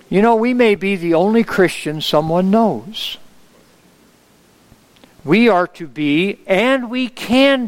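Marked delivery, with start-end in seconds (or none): none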